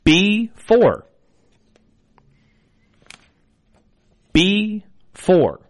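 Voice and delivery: slow, drawn-out number calling; bingo caller. Acted. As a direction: call each number clearly, then repeat. I will be your bingo caller.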